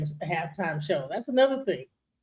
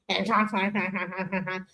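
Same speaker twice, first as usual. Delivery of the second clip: mock way of talking; 2 semitones lower, 6 dB quieter, much slower than usual. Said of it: "he talks like that".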